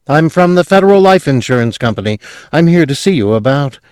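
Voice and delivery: high fast eager salesman pitch; bright eager young salesman, fast and high. Acted. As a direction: rattle off the sales opener. Insurance salesman voice.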